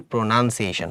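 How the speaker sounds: প্রোনাউন্সিয়েশন softly, with "nun" in the middle; short "nun", no "noun", in প্রোনাউন্সিয়েশন; correct pronunciation. Shown as a demonstration. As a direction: say প্রোনাউন্সিয়েশন as pro-nun-see-AY-shun. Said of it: "আমাদের প্রোনাউন্সিয়েশন হবে".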